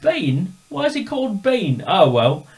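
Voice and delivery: high, loud incredulous repeat; squeaky bewildered echo; mock disbelief. The speaker mocking his own bewildered reply.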